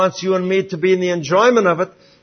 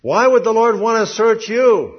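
preaching; 8 semitones higher, 4 dB louder than usual. Real, not acted. Preacher's rhetorical challenge.

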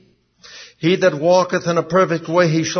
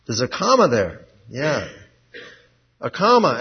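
normal talking voice

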